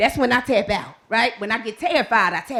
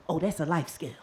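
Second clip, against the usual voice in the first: ordinary talk, nothing marked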